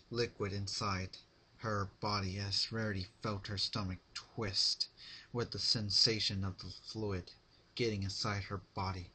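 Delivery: usual speaking voice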